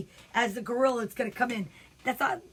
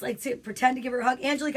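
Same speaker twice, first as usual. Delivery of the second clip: ordinary delivery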